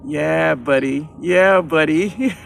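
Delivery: amused. Real, not acted. Laughing at caterpillar.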